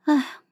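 soft, resigned sigh; light sigh. Acted. Princess before explaining to the Xiao girl, a soft sigh. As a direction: let out a gentle sigh. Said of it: "我轻叹了一声".

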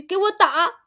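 mock yell of a mother's order; imitated shout. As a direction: call it out high, imitating an angry mum. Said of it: "要不是老妈你刚才喊了一句".

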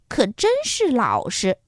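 usual speaking voice

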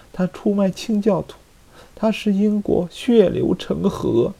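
bitter accusing lady; scheming court lady. Acted. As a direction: paint him as a villain, bitterly. Milady accuses Buckingham.

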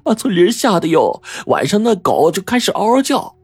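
high, gossipy and thrilled; delighted landlady. A narrator's reading. Landlady recalling the landslide and bodies, happy high gossip. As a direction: relish the gossip, pitch climbing high. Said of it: "她高兴地说".

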